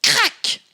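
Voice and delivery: loud high snap of breaking wood; sharp crack sound. A narrator's reading. Narrator voicing the chair snapping, high and loud.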